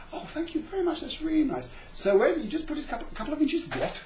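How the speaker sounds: high, eager then baffled; the little guy thanks you, then can't follow; high bewildered mock voice; flatlander voice. Flatlander confused by above.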